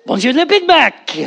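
hammed-up French accent, high; mock French. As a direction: squeal the one phrase he knows. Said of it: "all he's got is his one phrase".

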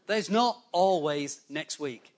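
"not always next week" slowly spaced out; slow, pointed repeat. Real, not acted. Preacher drives the warning home to the congregation, slow and deliberate.

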